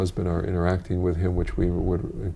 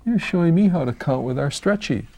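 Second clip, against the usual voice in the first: excited praise; 8 semitones higher than usual. Acted. Praising a child in therapy.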